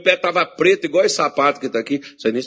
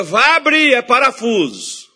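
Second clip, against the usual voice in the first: much higher, louder alarmed doctor; doctor warning; 9 semitones higher, 5 dB louder, slower than usual. Retold or imitated. Doctor warning the pastor his foot will open, alarmed. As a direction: warn him it'll burst open. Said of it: "o médico vinha e dizia".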